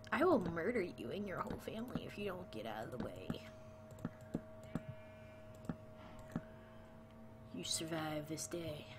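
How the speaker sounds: hushed, rapid threat fading into half-whisper; mock menacing whisper. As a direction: mutter a playful death threat under your breath. Mock threat to mobs blocking the way.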